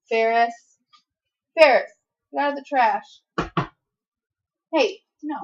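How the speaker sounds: loud sharp call to the dog. Real, not acted. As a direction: shout at the dog to stop. Scolding dog in trash.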